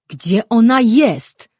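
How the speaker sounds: loud, slow, drawn-out shout. A narrator's reading. Mirar shouting at the twins for Auraja's whereabouts, slow loud shout. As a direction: demand it at full voice. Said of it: "krzyknął Mirar".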